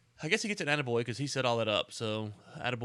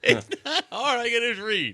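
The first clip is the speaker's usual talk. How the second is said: loud laughing jab at the co-host; laughing, loud teasing; 11 semitones higher, 10 dB louder than usual. Real, not acted. Co-host teasing his partner, loud and laughing.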